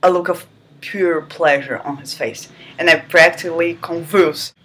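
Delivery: ordinary talk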